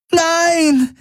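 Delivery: a very high, desperate shout; piercing scream of grief. A narrator's reading. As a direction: cry out as he falls dead. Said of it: "schrie Kirai".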